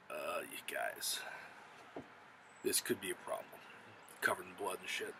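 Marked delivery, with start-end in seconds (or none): 0.1-3.5 deflated warning. Retold or imitated. Jorah returns from lost charge.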